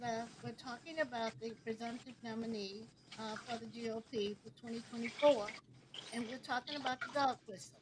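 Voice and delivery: faint, soft voice, far from the mic; speaking so quietly it barely carries; barely audible. Real, not acted. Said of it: "I can barely hear you".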